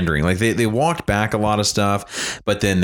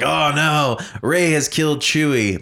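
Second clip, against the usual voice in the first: mock dramatic; 7 semitones higher, slower than usual. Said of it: "cheap little emotional ploy to be like".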